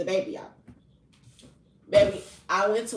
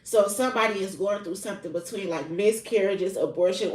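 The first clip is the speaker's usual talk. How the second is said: ordinary talk, nothing marked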